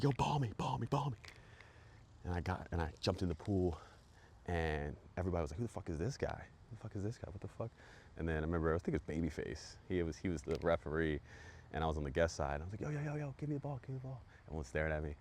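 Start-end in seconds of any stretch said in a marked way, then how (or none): 0.0-1.2 high, loud hyped shout for the ball; pitched-up eager shout across the pool; hyped call for the ball. Retold or imitated. Yelling for the ball. 5.6-7.7 imitating shocked crowd. Crowd reacting in disbelief. 12.8-14.2 high-pitched hyped plea; pitched-up excited yelling at the referee; eager call for the ball. Retold or imitated.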